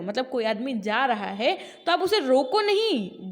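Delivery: usual speaking voice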